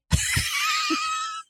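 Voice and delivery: very high squealing laugh. Pretend. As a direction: shriek out a tiny squeal of laughter. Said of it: "baby voice".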